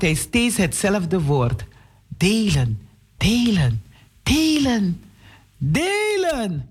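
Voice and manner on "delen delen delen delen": repeated word, rising higher each time; echoing in his head. A narrator's reading. Boy hears the word echo in his head, rising. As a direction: let the word haunt him, climbing up.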